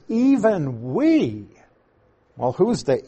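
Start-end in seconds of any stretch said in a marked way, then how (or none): 0.1-1.5 "even we" slow and high, with "we" soaring; triple emphasis. Shown as a demonstration. Teacher summing up the triple emphasis, "even we" slow and heavily stressed. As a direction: stretch "even we" right out, lifting high on "we". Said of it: "triple emphasis on the words".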